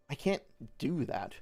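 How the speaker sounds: "do" stressed and stretched after "can't"; emphasis on "do", set apart from "I can't". Shown as a demonstration. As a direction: lean on the word "do". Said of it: "I put an emphasis on a different word".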